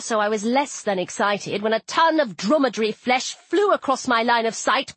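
ordinary delivery, nothing marked

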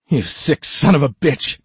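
loud, high furious shout. Acted. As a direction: hurl it at him in rage. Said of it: "Magnolia shouted".

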